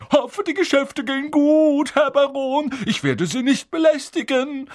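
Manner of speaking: very high, loud servile farewell; grovelling, loud and high; fawning poltergeist. A narrator's reading. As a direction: call out obsequiously in a piercing voice. Poltergeist grovels while leaving.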